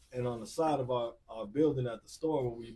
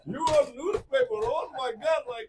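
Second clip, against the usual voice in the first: very high, loud, slow, laughing disbelief; excited store clerk; 12 semitones higher, 9 dB louder, slower than usual. Retold or imitated. Corner store clerk spotting him in the paper, high and laughing. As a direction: squeal it like a starstruck shopkeeper.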